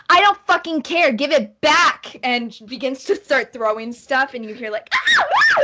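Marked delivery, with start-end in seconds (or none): 0.1-2.1 yelled high and loud, spitting fury; furious shout. Acted. The girl in green screams at her sister for the book. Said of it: "And she goes". 4.9-5.6 screeching high and loud, sisters fighting; high girlish screams. Acted. Two sisters shriek as they fight over a book. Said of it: "They're screaming at each other".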